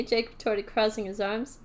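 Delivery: usual speaking voice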